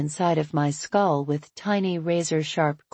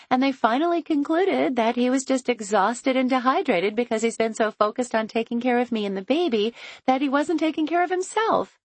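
excited; 6 semitones higher than usual. A narrator's reading. Chloe, laughing, relieved news.

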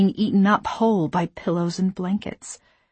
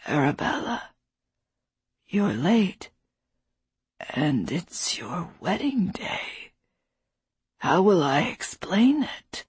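put-on weak old man; 3 dB quieter, slower than usual. A narrator's reading. Dying father, confused.

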